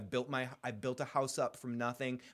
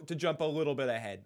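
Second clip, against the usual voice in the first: ordinary delivery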